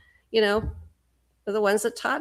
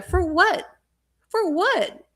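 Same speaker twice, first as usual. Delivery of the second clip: incredulous high question; 8 semitones higher, slower than usual. Real, not acted.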